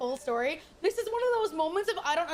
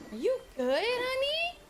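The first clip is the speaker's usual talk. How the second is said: rising high at the end; retold snide check-in, lilting up high; mock concern; 9 semitones higher than usual. Streamer retelling her reply to an angry player, mock-concerned.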